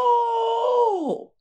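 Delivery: high loud anguished oh; pained cry. Acted. Servant cries out in pain as madam hits him, high and loud. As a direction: cry out as if struck.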